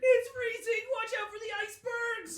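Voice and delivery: panicked shout. Acted. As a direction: yell over the icy wind. Acted panic on freezing ship.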